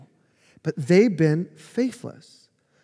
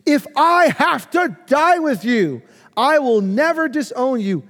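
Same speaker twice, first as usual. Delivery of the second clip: emphatic insistence; 8 semitones higher, 5 dB louder than usual. Retold or imitated. Peter arguing with Jesus, an emphatic insistent vow. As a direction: swear it loudly, refusing to back down. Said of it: "Peter insisted emphatically".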